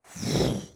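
a long, overwhelmed exhale; deep sigh. Retold or imitated. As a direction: breathe out as if flooded with feeling. Overwhelmed by the Holy Spirit.

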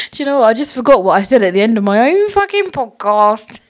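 high, loud, laughing disbelief; amused exasperation. Real, not acted. Host forgot the podcast sign-off, laughing exasperation. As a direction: laugh loudly at your own slip.

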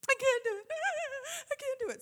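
mock high-pitched child's wail; screaming meltdown. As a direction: imitate a kid losing her mind, way up high. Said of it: "she screams".

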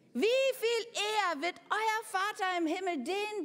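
preaching climax. Acted. Predigthöhepunkt, laut gerufen.

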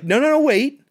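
sudden high urgent realization; excited man voice. Retold or imitated. Voicing Brolin in movie recap.

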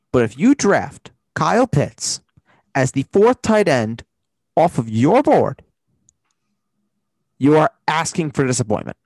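slow, loud and emphatic; emphatic warning. Acted. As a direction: lean hard on each phrase. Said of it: "something very important to say and emphasize".

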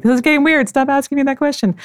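high-pitched, worked-up inner protest; retold freaked-out protest. Retold protest at retreat chanters.

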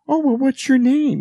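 high, loud, slow creepy coaxing; creepy clown voice. Retold or imitated. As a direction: lure the kid in, sing-song. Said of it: "he's like".

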